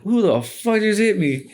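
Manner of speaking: high, drawn-out retold disbelief; mock-startled, stretched-out question; startled outburst. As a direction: act out being blindsided, pitch shooting up. Retold reaction to sudden punch.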